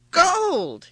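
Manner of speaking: high, loud cry of delight; joyful exclamation. A narrator's reading. King sees the golden field, exclaiming with joy. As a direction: burst out as the overjoyed king. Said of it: "the king gasped with joy".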